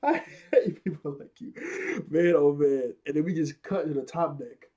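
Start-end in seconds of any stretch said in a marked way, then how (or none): 0.0-1.5 repeating the quote through real laughter; laughing repeat. Real, not acted. Retelling Luffy's line, laughing through it. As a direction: giggle the line back.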